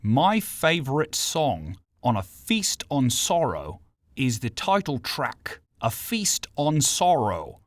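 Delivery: slow, deliberate fake American; American accent. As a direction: drawl it out in mock American. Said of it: "trying to do an American accent".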